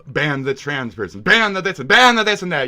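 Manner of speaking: mocking quote. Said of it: "And by saying".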